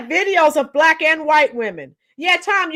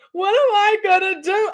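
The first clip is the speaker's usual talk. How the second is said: mock whining; 6 semitones higher than usual. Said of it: "They're on there crying".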